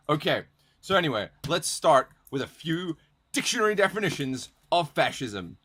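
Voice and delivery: ordinary talk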